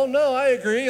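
high, bright-eyed "Oh, no, I agree", covering real disagreement; fake agreement. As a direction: hide your real opinion behind eager agreement. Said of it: "And so sometimes I go".

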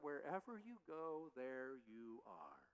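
slow, hushed, drawn-out saying. Shown as a demonstration. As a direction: let the old saying linger, unhurried. Quoting the old saying slowly.

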